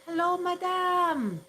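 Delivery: high, loud, drawn-out hail; imitating a distant call. Delivery girl hailing a passing customer, high stretched call. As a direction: call out long and high, as if from afar. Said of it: "and I'll hear".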